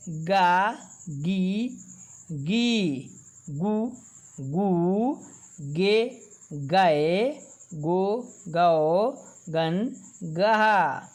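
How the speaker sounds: slow, deliberate recitation of each syllable; teacher reciting the barahkhadi chart one syllable at a time; syllable drill. Shown as a demonstration. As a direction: call out each sound clearly for the class to repeat.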